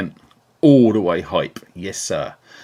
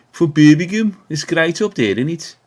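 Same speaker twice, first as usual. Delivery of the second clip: higher-pitched mock Brummie; bad Birmingham accent; 7 semitones higher than usual. Greeting a viewer from Birmingham in a bad Brummie accent. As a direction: put on a hammed-up Birmingham accent. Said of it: "I'm really terrible with accents".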